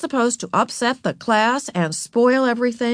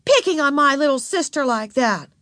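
higher protective scolding; indignant accusation; 7 semitones higher than usual. A narrator's reading. Beezus accuses Wayne of bullying, indignant. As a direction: stick up for a little sister.

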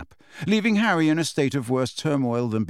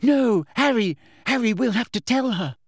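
cracked, desperate; 9 semitones higher than usual. A narrator's reading. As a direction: beg Harry urgently. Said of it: "in a cracked voice".

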